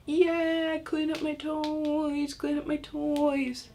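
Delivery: high, drawn-out mock cheer; gleeful over-the-top singsong; excited child. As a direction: cheer it in a high, sing-song kid voice. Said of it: "ever gonna be excited about".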